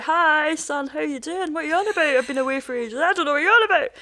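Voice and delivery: high-pitched, singsong impersonation of a dad; oblivious dad. Retold or imitated. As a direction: play a blithely clueless father greeting his son. Voicing oblivious dad reappearing to son.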